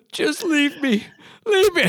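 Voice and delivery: hammed-up wail of a kid who crashed her bike; mock wail. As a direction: whimper it like a melodramatic kid. Crashed daughter's mock despair.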